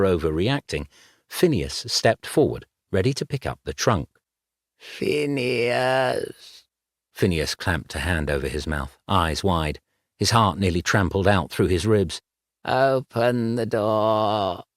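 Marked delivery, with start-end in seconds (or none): 4.8-6.6 cold, hollow ghostly call; eerie ghost voice. Acted. Ghost calls from outside. 12.6-14.6 slow, cold and grinding; cold ghostly voice. Acted. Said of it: "as cold as a pair of roof slates grinding".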